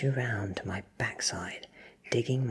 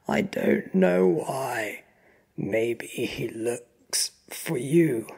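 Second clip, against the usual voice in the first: slow, loud, desperate answer; strained, fearful captive; frightened protest; 3 semitones higher, 6 dB louder, much slower than usual. A narrator's reading. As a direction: plead ignorance to your captor. Frightened captive under interrogation.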